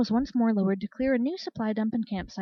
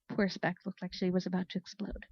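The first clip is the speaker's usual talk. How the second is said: ordinary delivery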